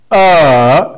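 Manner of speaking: "Uh" dipping, then rising; fall and rise. Shown as a demonstration. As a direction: dip "Uh" and lift it loudly at the end. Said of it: "Repeat after me".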